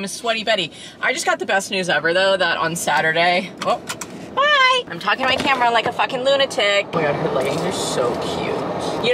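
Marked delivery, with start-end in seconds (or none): 4.3-4.8 squeaky bye. Real, not acted. Abrupt goodbye squeal.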